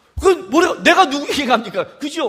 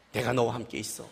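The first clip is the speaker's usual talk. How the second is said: very low and much softer; God's hushed reply; 14 dB quieter than usual. Retold or imitated. God calmly reassuring a doubting believer. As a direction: answer almost under the breath. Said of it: "하나님, 제가 그걸 어떻게 해요?".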